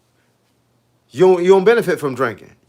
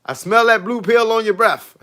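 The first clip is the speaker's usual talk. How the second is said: wife accusing; 5 semitones higher than usual. Retold or imitated. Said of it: "She said".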